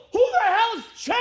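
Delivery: high, incredulous retold yell; loud, indignant outburst; outraged shout. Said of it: "And I out loud go".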